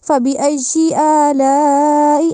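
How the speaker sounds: slow, drawn-out chanted فَبِأَيِّ آلَاءِ; slow recitation. Shown as a demonstration. Teacher reciting the verse for students. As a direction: recite it slowly, as in tilawat. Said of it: "recitation demo".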